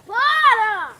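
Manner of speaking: loud sharp yell; shouting. Real, not acted. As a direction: shout it out to stop him. Yelling at friend to stop.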